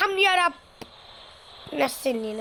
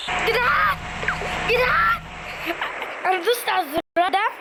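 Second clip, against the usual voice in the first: high, urgent calls to her friends; panicked calling out; 7 semitones higher than usual. Real, not acted. Hanging from the balloon, she calls out to her viewers in high alarm. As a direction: call out sharply, rising.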